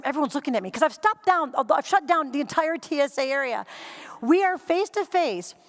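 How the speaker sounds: usual speaking voice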